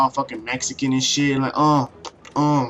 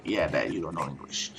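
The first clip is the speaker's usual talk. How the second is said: much lower, much softer teasing mutter; teasing aside; 11 semitones lower, 13 dB quieter than usual. Retold or imitated. Son teases dad about his English, low soft aside. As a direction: say it under your breath to dad. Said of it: "and I'm like".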